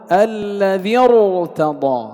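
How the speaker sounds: slow الذي ارتضى, joined straight into a thick r; heavy ra, silent hamza. Shown as a demonstration. Teacher recites the linked example, slow heavy ra. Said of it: "كذلك حكم الراء التفخيم".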